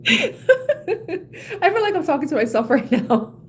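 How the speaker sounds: high, slow, laughing through the words; helpless laughter. Real, not acted. Reader realising she's advising herself, helpless laughter. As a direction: crack up while confessing. Cracking up at own advice.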